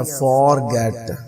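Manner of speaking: forget with a full long "or" in for; full first vowel. Shown as a demonstration. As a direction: say for in forget unreduced. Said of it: "अब ये नहीं कहना".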